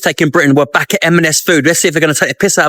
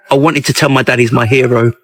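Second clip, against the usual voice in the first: normal talking voice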